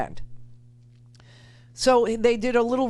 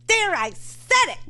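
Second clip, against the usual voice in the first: much higher mocking radio host voice; mock Mark Levin, pitched way up; imitating Mark Levin; 11 semitones higher than usual. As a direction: squeak it out like the host. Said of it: "you think when I imitate him".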